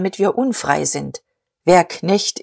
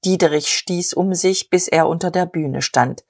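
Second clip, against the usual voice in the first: usual speaking voice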